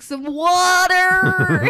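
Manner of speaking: drawn-out, loud, high American "water"; mocking American accent. Brit aping the American's "water", exaggerated American accent. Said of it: "just their dry humor".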